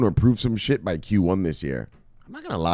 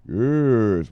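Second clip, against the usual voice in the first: ordinary talk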